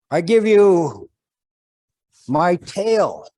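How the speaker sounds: high, loud ceremonial eagle voice; eagle's proclamation. Acted. Eagle offering its tail to the old man, high and loud. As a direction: bestow it grandly.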